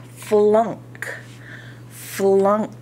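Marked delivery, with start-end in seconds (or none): none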